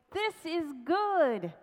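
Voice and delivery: high, loud, drawn-out cheer; exclaimed. A narrator's reading. Friend exclaiming after the king's thumb is shot off, jubilant. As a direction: crow it out slowly with full approval. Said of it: "The friend exclaimed".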